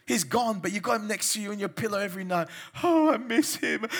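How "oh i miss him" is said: high whimpering imitation of a lovesick woman; mock heartbroken woman. Preacher mocking a woman who misses her ex, high and whiny. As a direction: whine it like a pining lover.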